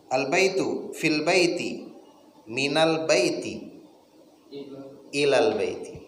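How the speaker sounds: al baitu fil baiti, minal baiti read slowly; slow recital of al baitu fil baiti; slow Arabic reading. Shown as a demonstration. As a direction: read the examples slowly for the class. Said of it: "reading Arabic example phrases".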